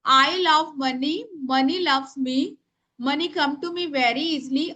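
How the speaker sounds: very slow, word by word; slow dictation to the class. Shown as a demonstration. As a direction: spell it out for writing. Repeating prayer.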